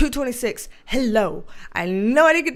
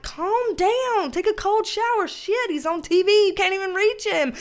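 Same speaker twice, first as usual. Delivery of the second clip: exasperated; 7 semitones higher than usual. Retold or imitated. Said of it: "I'm like".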